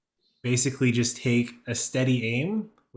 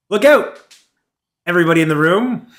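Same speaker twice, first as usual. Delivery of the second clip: high, very loud shout across the room; yelled warning; 6 semitones higher, 13 dB louder, much faster than usual. Acted. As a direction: yell a sudden warning to everyone, voice jumping up. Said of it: "as you yell out".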